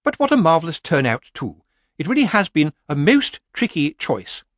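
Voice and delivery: brightly. Acted. Missus Earwig addresses the nervous crowd of witches, brightly. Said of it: "she said brightly".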